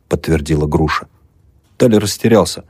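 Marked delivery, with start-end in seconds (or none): none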